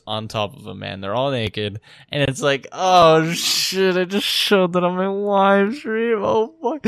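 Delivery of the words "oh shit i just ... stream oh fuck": higher, louder, drawn-out dismay; retold panic. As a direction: replay the dread of a live-stream blunder. Retells his panic on stream.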